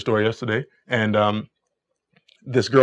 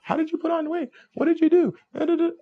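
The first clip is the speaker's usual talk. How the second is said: high, mocking girly voice; mock girl voice. Coach voicing a girl's small talk in high mock voice. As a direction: squeak it out like a chatty girl.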